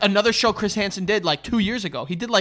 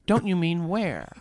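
slow, softer, lightly voiced host; deadpan host reading; 5 dB quieter, slower than usual. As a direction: drag out the gotcha line deadpan. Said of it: "exactly how he reads it too".

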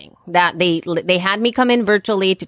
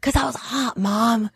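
put-on high, softer little-girl whine; whiny kid; 3 semitones higher, 5 dB quieter than usual. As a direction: complain like a sleepy child. Daughter voiced in story.

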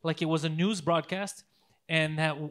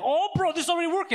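excited; 10 semitones higher, 4 dB louder, faster than usual. Retold or imitated. Friend excited about app.

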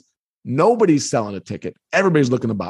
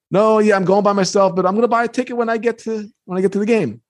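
nervous; 7 semitones higher than usual. Retold or imitated. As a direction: blurt it out nervously, pitched up, as a retold answer. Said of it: "And I'm getting nervous".

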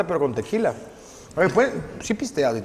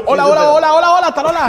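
mock dub line; 8 semitones higher, 6 dB louder than usual. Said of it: "Oye, tú".

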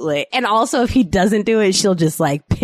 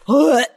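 higher gagging blech; disgusted blech; 7 semitones higher, 4 dB louder than usual. Real, not acted. Host rejecting raw eggs on the floor, revolted. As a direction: shudder it out in revulsion.

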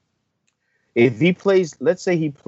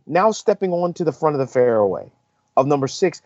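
usual speaking voice

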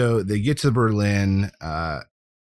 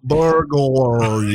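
put-on Russian accent; 3 semitones higher, 6 dB louder, much slower than usual. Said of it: "he's trying to say it in a Russian accent".

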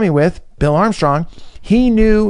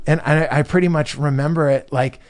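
usual speaking voice

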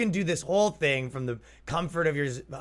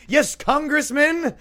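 high, slow, loud important-caller voice; mock phone call; 7 dB louder, slower than usual. Faking a phone call to dodge beggars, high and slow. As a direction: pretend to talk to a congressman.

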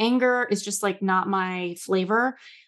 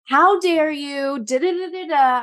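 high, loud, drawn-out mock outrage; angry venting; 8 semitones higher, 5 dB louder than usual. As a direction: act out an indignant rant that tumbles into nonsense syllables. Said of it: "what is the anger saying".

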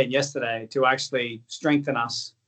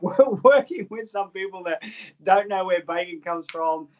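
laughing; 6 semitones higher than usual. Real, not acted. Laughing at dim footballers.

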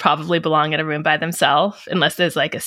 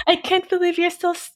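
high, silly voiced dog; 12 semitones higher than usual. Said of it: "as I do the dog voice".

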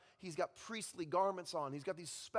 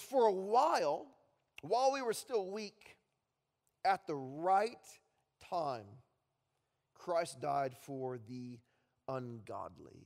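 scripture reading; 3 semitones lower, slower than usual. Acted. Pastor reading Romans to the congregation, slow and solemn. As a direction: read the verse slowly and let it sink.

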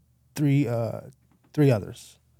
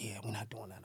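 muttered aside; 3 semitones lower, 20 dB quieter than usual. Retold or imitated. Rejecting the open window.

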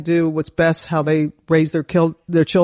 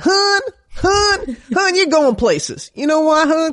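high, slow, drawn-out hun; coach's praise; 10 semitones higher, slower than usual. Retold or imitated. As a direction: praise her like an old coach. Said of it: "she said".

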